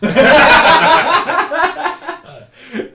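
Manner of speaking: high, long burst of laughter; big real laugh; loud laugh. Real, not acted. Laughing after joke insult.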